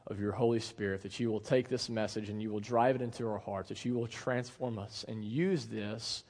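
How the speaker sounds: hushed public prayer, unhurried. Real, not acted. Pastor praying for the church before preaching, soft low prayer.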